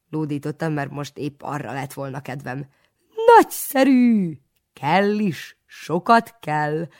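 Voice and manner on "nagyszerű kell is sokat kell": high, loud, slow jovial cry; hearty exclaim. A narrator's reading. Jovial priest exclaims his favourite word, high and loud. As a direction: bellow it with delight.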